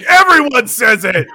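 high, loud burst of protest; emphatic exclamation. Real, not acted. Contradicting a friend's denial, loud exclamation.

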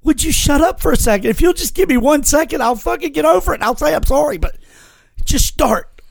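an irritated mock plea; exasperated retort. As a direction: snap back that you need a second. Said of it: "but it's like".

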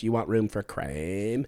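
slow, drawn-out imitation of fry; mock vocal fry. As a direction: stretch the question out in mock fry. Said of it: "people who talk like that".

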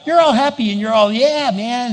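loud, high, fast cheerful yeah; happy cheer. Pretend. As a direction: burst out all happy. Acting out being happy.

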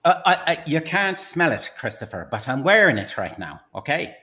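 salesman pitch in a put-on Irish accent; stage Irishman with a secret to share; Irish accent, pitching his invention to the host.

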